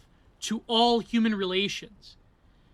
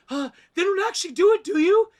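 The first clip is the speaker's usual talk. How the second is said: much higher, louder and quicker, anxious; anxious high Natalie; 10 semitones higher, 7 dB louder than usual. Retold or imitated. Natalie, shaken, asks Bingham if anyone does it: panicked high squeak.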